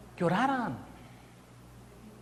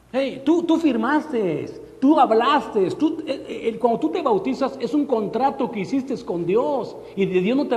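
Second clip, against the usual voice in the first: loud, driving sermon voice, higher up; preaching at full force; 5 semitones higher, 4 dB louder than usual. Acted. Preacher warning the congregation, in a loud driving voice. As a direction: hammer the point home to the congregation. Preaching about contracts with God.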